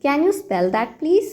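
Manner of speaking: very slow, teacherly model phrase; slow model sentence. Shown as a demonstration. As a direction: spell it out slowly for learners. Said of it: "तो आपको क्या कहना है". (बहुत धीमे, सिखाने वाले अंदाज़ में)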